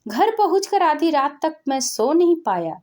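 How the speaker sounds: plain reading voice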